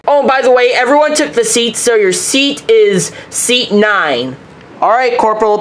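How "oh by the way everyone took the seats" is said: ordinary delivery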